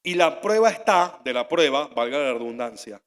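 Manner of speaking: ordinary talk, nothing marked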